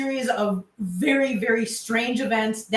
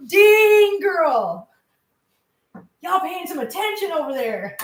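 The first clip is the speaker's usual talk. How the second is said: retold exclamation; 6 semitones higher, 4 dB louder, slower than usual. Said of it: "I was like".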